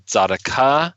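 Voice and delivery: Tzedakah with a full final KAH, rising; mispronounced Tzedakah. Shown as a demonstration. Husband showing the Hebrew word he was mispronouncing, mispronounced. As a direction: say Tzedakah the wrong way, weight off the middle, lifted at the end. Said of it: "I was mispronouncing".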